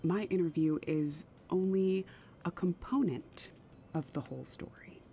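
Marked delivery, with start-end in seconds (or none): 3.9-5.0 whispered, trailing off. Acted. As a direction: let it drop to a whisper. Secret affair about to leak.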